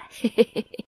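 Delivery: short high snickering laugh; acted servant's giggle; amused chuckle. A narrator's reading. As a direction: snicker knowingly to yourself. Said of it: "라고 웃으며".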